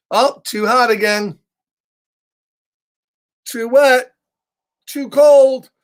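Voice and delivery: a picky bird rejecting the bath; fussy pigeon complaints. Acted. Reading the pigeon book to kids, fussy complaining.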